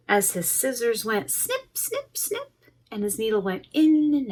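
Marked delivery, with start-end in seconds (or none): 1.3-2.5 snip sounds. A narrator's reading. Scissors sound in story.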